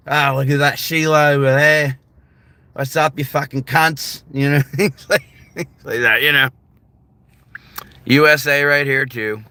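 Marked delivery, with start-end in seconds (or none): 0.1-4.2 hammed-up Australian greeting, R's dropped; Australian accent. Shown as a demonstration. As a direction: put on a cheeky Australian accent. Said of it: "My Australian accent".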